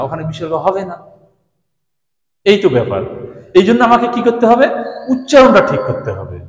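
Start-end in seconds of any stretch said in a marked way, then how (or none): none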